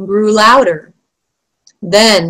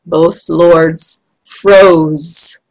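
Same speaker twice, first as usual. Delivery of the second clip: usual speaking voice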